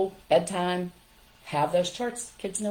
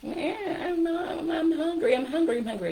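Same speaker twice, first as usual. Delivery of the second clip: put-on whiny child; 11 semitones higher than usual. Parent imitating a child whining for food at dinnertime, high whine. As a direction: whine like a hungry child nagging. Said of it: "say your child's whining".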